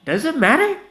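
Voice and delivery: put-on high, loud careless drunk; tipsy remark. Vanilla shrugging off his drinking, high loud voice. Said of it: "he remarked".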